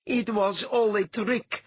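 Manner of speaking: high, slow, distraught cry; wailing. A narrator's reading. Letha realises she was fooled, wailing. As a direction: wail it out in despair. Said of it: "Letha wailed".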